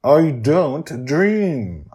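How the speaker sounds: slow, through gritted teeth. Acted. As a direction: force it out between clenched teeth. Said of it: "through gritted teeth".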